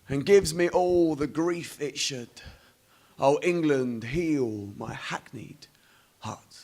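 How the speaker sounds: slow, softening close of a poem; hushed, drawn-out final refrain, dropping away at the end; poem recital. Acted. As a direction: let the last lines sink and fade slowly. Closing lines of the poem.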